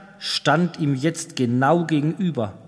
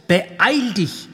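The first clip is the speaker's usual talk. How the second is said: ordinary delivery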